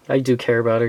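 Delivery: ordinary talk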